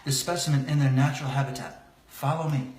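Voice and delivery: hushed wildlife narrator voice; nature documentary. Acted. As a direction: whisper like stalking animals.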